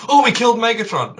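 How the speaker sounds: high, loud mock cheer of victory; imitating the heroes celebrating; mock triumphant cheer. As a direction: cheer it like you just won. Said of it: "It's like".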